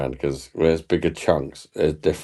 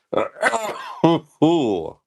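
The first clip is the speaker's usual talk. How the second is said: high giggle; 11 semitones higher than usual. Real, not acted. Cracking up at the stream.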